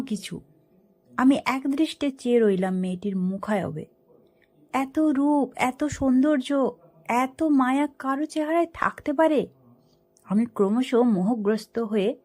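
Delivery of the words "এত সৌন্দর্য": ordinary delivery, nothing marked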